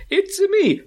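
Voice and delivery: high, squeaky cartoon voice; exaggerated high game-hero voice; Mario. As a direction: pitch it way up into a startled cartoon greeting. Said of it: "the mushroom scares him".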